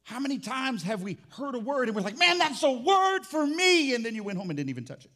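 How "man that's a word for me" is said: loud, high, drawn-out mock enthusiasm; hyped congregant. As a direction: shout it like a thrilled churchgoer. Mimicking a thrilled congregant.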